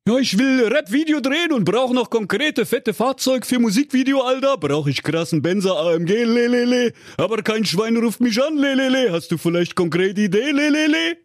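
high cartoon slang voice with lelele tags; a cocky wannabe rapper asking for a car; street rapper voice. A narrator's reading. The rapper asks Honk for a flashy car, high slangy voice. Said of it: "der nichtsnutzige Rapper sprach".

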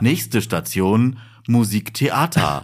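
very slow, drawn-out computer announcement; flat robotic monotone. Retold or imitated. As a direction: stretch each word like a tram robot. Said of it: "so eine furchtbare Computerstimme".

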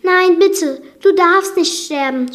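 high, loud, tearful begging; storyteller's distressed cry; sobbing plea. A narrator's reading. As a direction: beg as if close to sobbing. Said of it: "schluchzte Belle".

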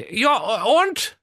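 very high, squeaky king voice; comic king voice. Acted. (hohe quäkige Königsstimme)

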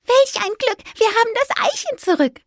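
high, delighted squeak; happy mouse. A narrator's reading. Mouse cheering at the recovered egg. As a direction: sigh of relief in a tiny voice. Fipper relieved.

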